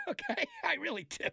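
high, through a laugh. Real, not acted. Host insisting on his own advice, laughing. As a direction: say it while still laughing.

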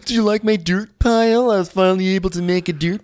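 hammed-up needy chef showing off his spot; mock Matt voice. Podcaster mimicking chef showing guests his station, high and whiny.

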